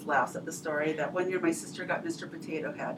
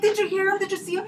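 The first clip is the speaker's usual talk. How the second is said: much higher, eager questions; excited kids; 10 semitones higher, faster than usual. Retold or imitated. Kids ask dad if he saw Santa, high and eager. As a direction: pepper dad with breathless questions.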